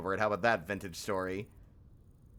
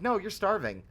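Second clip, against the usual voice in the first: higher, louder, nagging singsong; nagging game voice; 8 semitones higher, 4 dB louder than usual. Retold or imitated. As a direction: pester like an annoying reminder. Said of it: "and the game's like".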